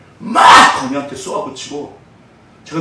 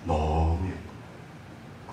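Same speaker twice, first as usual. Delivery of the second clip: very slow, much softer, lower; tender adoration; 9 semitones lower, 13 dB quieter, much slower than usual. Real, not acted. Pastor tells the congregation how lovely his son is, very slow and soft. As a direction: savour each word with love. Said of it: "이쁘다, 안 이쁘다?".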